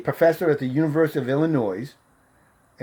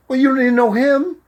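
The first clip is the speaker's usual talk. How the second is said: high, loud mock protest; retold protest; 12 semitones higher, 8 dB louder than usual. Girl begging a ride argues back, in loud high protest. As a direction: imitate her objecting, pitched way up.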